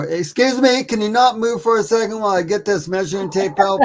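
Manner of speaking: high, exaggerated polite request; mock politeness. Joking to the listener about measuring the creature, mock polite. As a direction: ham up the courteous ask.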